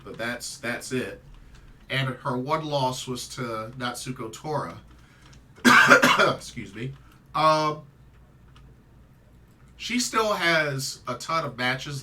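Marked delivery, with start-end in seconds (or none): 5.6-6.3 sharp dry cough; a sudden loud cough. Real, not acted. Coughs mid-sentence.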